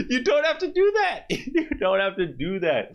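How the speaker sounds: high, exasperated squeal through laughter; laughing mock protest. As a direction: plead through your laughter, pitched high. Acted protest at dad, laughing.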